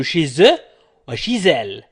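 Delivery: "chez eux" and "chez elle" both linked with z; model liaison. Shown as a demonstration. Teacher modelling for students to repeat, liaison Z. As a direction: sound shee-ZUH, then shee-ZAL. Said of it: "حاولوا أن تقولوا".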